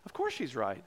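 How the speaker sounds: sheepish realisation. Retold or imitated. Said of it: "And I was like".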